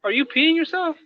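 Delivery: imitating a stranger's shocked high question; startled high call. Onlooker noticing the pee, calling out in high mock alarm. Somebody's like.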